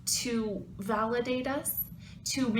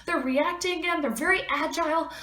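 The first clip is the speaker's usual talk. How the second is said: much higher, pouring it all out to mom; upset excited rant; 9 semitones higher than usual. Retold or imitated. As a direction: gush it in a rush, hurt and proud. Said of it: "I called my mother and I said".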